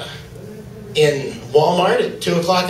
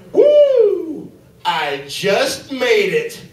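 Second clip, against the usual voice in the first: mock relieved whoop; 6 semitones higher, much slower than usual. Relieved guy in restroom.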